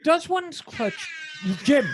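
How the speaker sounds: BBC voice. Acted. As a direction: put on your best formal BBC British. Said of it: "in my best B B C voice".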